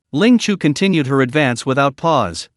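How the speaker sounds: usual speaking voice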